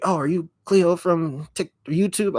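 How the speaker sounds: high, excited fan question; eager fan. Retold or imitated. Vlogger imitating fans who spotted her, high and excited. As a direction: play a fan thrilled to recognise her. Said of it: "people are met-, like".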